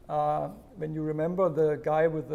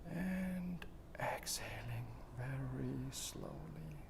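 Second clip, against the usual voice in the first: whispered guidance; 3 semitones lower, 15 dB quieter, much slower than usual. Shown as a demonstration. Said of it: "whispered guidance".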